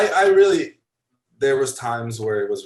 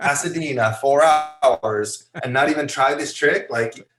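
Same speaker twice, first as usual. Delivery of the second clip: higher, louder mock scolding; retold goading; 5 semitones higher, 5 dB louder than usual. Photographer messes with skater's head, indignant goading. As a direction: push back indignantly, imitating the photographer. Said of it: "him fucking with your head".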